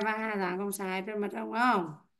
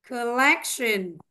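Collection said slowly, middle syllable stressed; stress demo; much slower than usual. Shown as a demonstration. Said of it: "cô đọc và các bạn theo dõi". (đọc chậm Collection, nhấn âm giữa)

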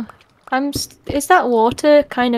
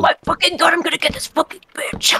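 snarling angry skeleton, pitched up; 6 semitones higher, faster than usual. Retold or imitated. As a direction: growl it out like a furious skeleton mob. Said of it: "That's a nice skeleton impression".

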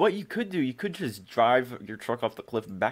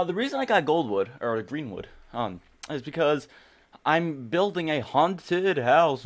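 ordinary talk, nothing marked

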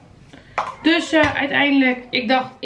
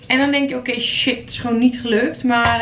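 ordinary talk, nothing marked

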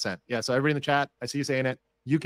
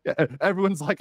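ordinary talk, nothing marked

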